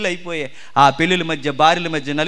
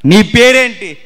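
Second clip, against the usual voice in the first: louder, higher, thrown at the crowd; loud repeated question; 7 semitones higher, 10 dB louder, slower than usual. Acted. Preacher to congregation, loud probing question. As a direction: fire the question at the listeners. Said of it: "preacher repeats question to crowd".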